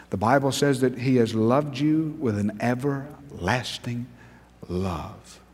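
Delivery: tender pulpit hush; hushed sermon. Acted. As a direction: lean in and speak it gently. Soft sermon climax.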